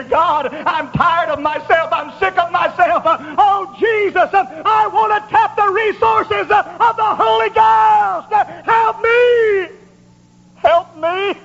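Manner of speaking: high plea building to a drawn-out shout; desperate cried prayer. Acted. Sinner worn out with himself begs God, desperate cry.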